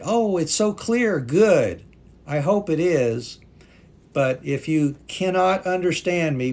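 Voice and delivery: ordinary delivery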